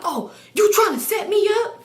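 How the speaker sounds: high, indignant imitation of him; hammed-up high-pitched challenge; mock accusation. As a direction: act out his outraged accusation. His acted accusation.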